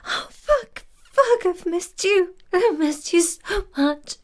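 high, loud gasping climax cries. Acted. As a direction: cry out at the peak.

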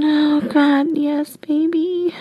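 slow moan rising in pitch. Acted. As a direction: draw the words out as a moan. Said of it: "And I moan".

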